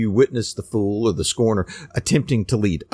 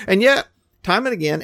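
ordinary talk, nothing marked

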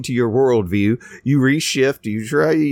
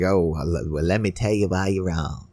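mock condescension; 7 semitones lower, 5 dB quieter than usual. Mocking a condescending corrector.

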